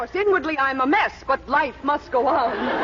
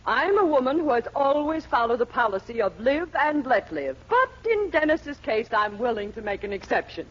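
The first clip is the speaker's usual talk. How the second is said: ordinary talk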